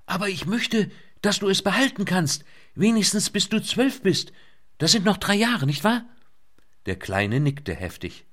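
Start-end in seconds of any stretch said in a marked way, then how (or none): 0.0-6.0 put-on young woman shouting. A narrator's reading. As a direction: raise voice over the din. Lynette keeps shouting over rotors.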